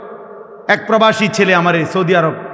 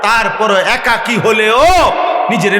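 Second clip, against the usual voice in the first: full-force preaching; 5 semitones higher than usual. Real, not acted. Preacher urging believers to hold themselves firm, impassioned exhortation. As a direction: drive it home to the whole congregation.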